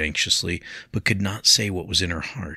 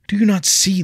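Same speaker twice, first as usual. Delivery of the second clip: much higher and louder appeal; urgent plea; 9 dB louder than usual. A narrator's reading. William pressing Leah, high and loud. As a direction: make her understand, now.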